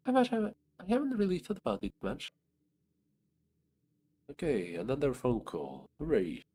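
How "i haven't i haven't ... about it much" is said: tiny ant voice. Acted. Ant character voice.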